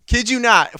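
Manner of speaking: ordinary delivery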